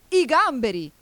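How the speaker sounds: loud, high, excited shout; wild yell. A narrator's reading. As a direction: yell it out like a madcap griffin. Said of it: "urlò il grifone saltando come un matto".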